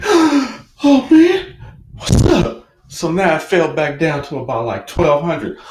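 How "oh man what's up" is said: much higher, louder shocked outcry; shocked gasp. Retold or imitated. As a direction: caught off guard by the drop. Crypto drops, he gasps.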